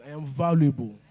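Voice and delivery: loud firm declaration; repeat the declaration. Shown as a demonstration. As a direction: declare it again, with conviction. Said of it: "I want you to say it again".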